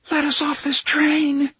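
put-on high, urgent voice of a trapped child; terrified plea. A narrator's reading. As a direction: beg in a high, frightened voice. Terrified kids' faces plead.

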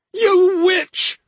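very high, loud shriek; enraged scream. A narrator's reading. Enraged brother turning on his sister, screaming. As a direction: shriek the insult at her. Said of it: "he screamed at her".

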